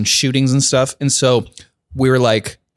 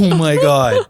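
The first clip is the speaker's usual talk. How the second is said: overcome, lightly acted relief; retold weeping; slower than usual. Retelling smelling a candle again, mock-tearful relief. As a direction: imitate being moved to tears. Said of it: "And I openly wept".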